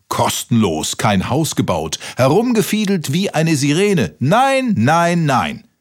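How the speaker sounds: outrage climbing to high-pitched refusals; hammed-up exasperated tirade; indignant scolding. A narrator's reading. Beetle scolds while chasing her out.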